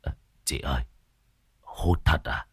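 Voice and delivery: low, soft nervous question; timid old man. Acted. Tam timidly asking if she'll really smoke, low and soft. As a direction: ask meekly, afraid.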